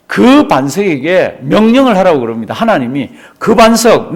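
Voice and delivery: ordinary talk, nothing marked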